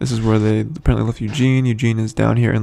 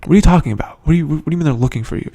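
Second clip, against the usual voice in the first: higher, quick incredulous questioning; retold disbelief; 5 semitones higher, 4 dB louder, much faster than usual. Group questioning Eugene's claim, incredulous. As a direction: question it in mild mock bafflement.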